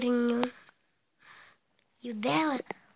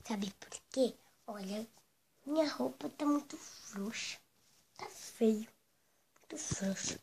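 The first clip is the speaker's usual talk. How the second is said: confiding whisper; 7 dB quieter than usual. Acted. Confiding about clothes.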